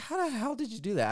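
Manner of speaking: high-pitched retold disbelief; incredulous. Husband asks wife how she fixed it, incredulous. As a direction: pitch it way up in amazement. Retelling his amazement at wife's fix.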